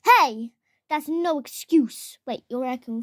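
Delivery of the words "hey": sharp shout. Acted. Rebuke of raccoon excuse.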